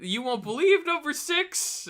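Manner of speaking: high, slow, hyped clickbait tease; clickbait video narrator. Retold or imitated. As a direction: sell the headline like a teaser. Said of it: "BuzzFeed quote".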